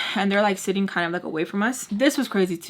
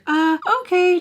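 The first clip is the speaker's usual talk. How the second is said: high, louder, uncertain okay; imitated nice waitress; 10 semitones higher, 5 dB louder than usual. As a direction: pitch it way up as the polite, thrown waitress.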